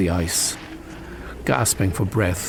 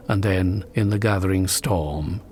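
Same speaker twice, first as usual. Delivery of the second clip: usual speaking voice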